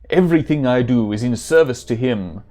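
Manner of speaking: put-on German-accented warrior; hammed-up German accent. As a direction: play it as an earnest German hero. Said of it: "supposed to be a German accent".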